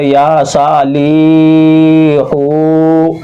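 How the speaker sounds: या साली हो with every vowel dragged very long; exaggerated slow chant, holding ली and हो; wrongly overstretched. Shown as a demonstration. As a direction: mistaken reading, final syllables stretched out. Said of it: "ऐसे नहीं पढ़ना है".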